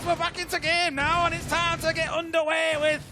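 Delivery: high, loud put-on Brummie accent; a hammed-up Brummie caster voice. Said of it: "I can only get through about half a sentence".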